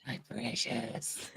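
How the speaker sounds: high, very slow, soft Gollum croon. Pretend. Joking about the lawyer as Gollum, high and slow. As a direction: draw it out like Gollum. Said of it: "When did Gollum become a lawyer?".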